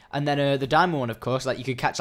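ordinary talk, nothing marked

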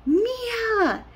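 very high, drawn-out mock scream; imitated scream. Son screams for his twin sister as doors close, imitated scream. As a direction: mimic a frightened child's cry, not full volume. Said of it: "But Liam screams".

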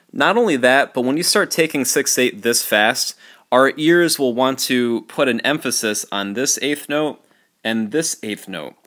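usual speaking voice

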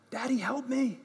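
put-on high little child's plea; child crying out. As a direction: plead like a hurt little kid. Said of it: "wanna cry out".

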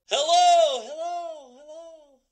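drawn-out high hello fading away; calling out. Acted. Calling to see if anyone answers, high call fading out.

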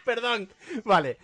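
normal talking voice

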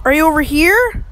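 loud call across the room. Real, not acted. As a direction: shout it out to find him. Calling out to a hidden zombie.

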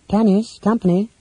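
ordinary delivery, nothing marked

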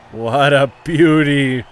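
slow, loud and savoured; drawn-out praise. Real, not acted. Gamer savouring the hit, slow loud drawn-out praise. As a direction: stretch every word out.

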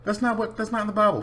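high mocking voice of a preachy critic. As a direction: whine it like a self-righteous critic. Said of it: "some people are gonna be like".

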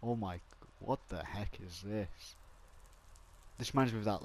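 ordinary talk, nothing marked

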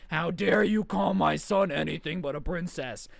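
slow, strained mock-outraged mom; hammed-up indignant mom voice; mock screaming. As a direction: imitate a screaming mother, drawing out the words. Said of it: "His mom is screaming at principal saying".

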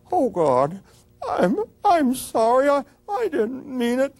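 high, choked plea of sorrow; anguished apology. Retold or imitated. Freddie kneeling over Joni, begging forgiveness through tears. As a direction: sob out the apology.

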